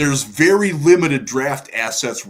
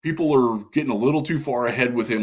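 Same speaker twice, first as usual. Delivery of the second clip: normal talking voice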